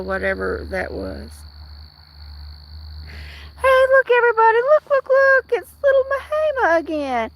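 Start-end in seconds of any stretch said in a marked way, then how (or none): 3.6-7.3 high, squeaky cat-greeting voice; excited high voice. Real, not acted. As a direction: coo brightly at the cat. Showing the cat to viewers.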